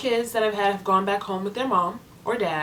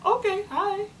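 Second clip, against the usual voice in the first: awkward high greeting; 12 semitones higher, 3 dB quieter than usual. Retold or imitated. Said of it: "And I was like".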